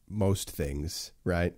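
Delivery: ordinary talk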